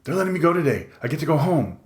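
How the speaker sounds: high-pitched, delighted announcement; eager, excited character voice. A narrator's reading. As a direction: blurt out wonderful news. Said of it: "He's so excited".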